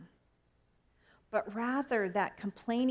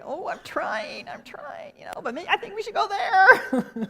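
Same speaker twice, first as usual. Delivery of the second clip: laughing self-quote; 11 semitones higher, 10 dB louder than usual. Retold or imitated. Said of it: "I'm like".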